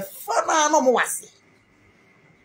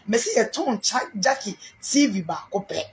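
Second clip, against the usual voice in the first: ordinary talk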